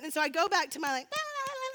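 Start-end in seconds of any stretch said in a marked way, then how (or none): 1.1-1.7 high-pitched "la la la" squeal; silly excited babble. Retold or imitated. Going back to her fun with friends, silly high babbling. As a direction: babble giddily up high, mock carefree. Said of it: "see me do that again".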